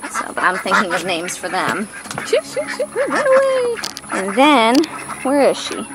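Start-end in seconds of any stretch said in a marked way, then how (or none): 2.3-3.8 very high shoo-shoo. Real, not acted. Owner shooing the ducks out of the way, very high. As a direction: shoo them off in a high squeak.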